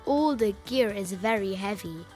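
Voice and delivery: ordinary delivery, nothing marked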